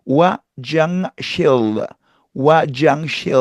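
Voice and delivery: put-on 화장실 said as 와장실, American-accented Korean; slow foreign 와장실. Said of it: "그들은 와장실".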